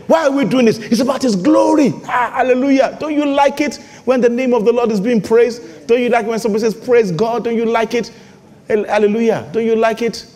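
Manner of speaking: high-energy preaching, pressing each point; fired-up preaching. Real, not acted. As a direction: exhort the congregation with rising fervour.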